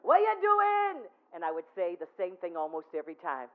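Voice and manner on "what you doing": high, loud call across the street; loud, high greeting shout. Retold or imitated. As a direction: holler it out to a passerby. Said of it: "he never ever once talked softly".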